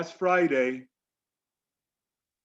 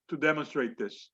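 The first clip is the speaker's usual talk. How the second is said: normal talking voice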